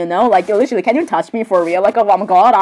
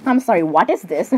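ordinary talk, nothing marked

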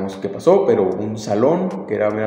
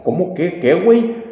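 higher, rising, bewildered questions; baffled protest; 6 semitones higher than usual. Retold or imitated. Student accused of arson by the principal, stunned confusion. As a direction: act totally caught off guard.

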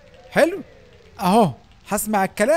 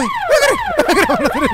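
panicked shriek; 7 dB louder than usual. Real, not acted. Fleeing the principal in panic.

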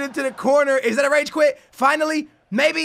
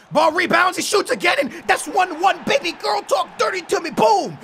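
high-pitched, excited goal call; real burst of match excitement; hyped up; 5 semitones higher than usual. Real, not acted. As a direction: get carried away as the goal goes in. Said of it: "Sorry, I got hyped right there".

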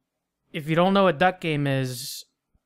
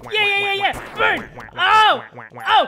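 high, loud victory shouts; hype yelling; 13 semitones higher, 4 dB louder than usual. Real, not acted. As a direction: roar it like a winner. Triumphant hype.

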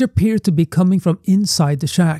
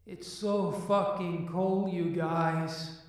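distant echoing voice from below; down a well; 2 semitones higher, 17 dB quieter, slower than usual. Acted. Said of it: "Logan's voice said".

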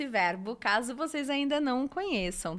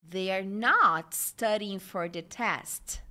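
slow, lower sentence with "not" punched and drawn out; negative intonation; 4 semitones lower, slower than usual. Shown as a demonstration. Teacher demonstrates negative intonation, slowly leaning on "not". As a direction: stretch and stress "not" in "They are not studying", falling on "test". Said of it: "essa entonação é muito importante".

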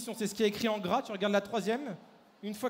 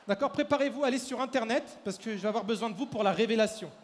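usual speaking voice

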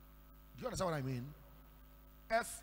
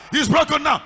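preached shout; 9 semitones higher, 24 dB louder than usual. Real, not acted. Preacher repeats declaration.

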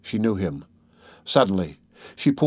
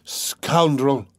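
mildly indignant, voiced rather than whispered; 5 semitones higher than usual. A narrator's reading. Dunya insulting her tormentor, indignant. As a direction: spit the insult with restrained indignation. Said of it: "whispered Dunya indignantly".

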